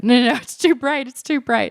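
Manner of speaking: playfully fending off a kiss; mock protest. Pushing away the guy who tried to kiss her, high mock protest.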